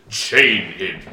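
low, loud, commanding boom; deep booming voice. Acted. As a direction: boom out the order like an unseen master. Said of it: "a deep booming voice that says".

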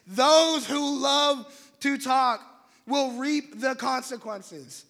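slow, loud, drawn out; slow, emphatic scripture read; emphatic preaching. Real, not acted. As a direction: hammer each word home.

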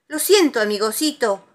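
high, loud, sweet apology; apologetic Tiger. A narrator's reading. Tiger to friend knocked over, apologetic. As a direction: apologise brightly.

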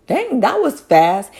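mock the parents' surprise; imitating parents surprised at the quick return.

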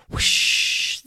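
loud rush of hissed air; spray-gun sound effect; whooshing noise. Acted. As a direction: blast out a long whoosh. Voicing the nozzles firing.

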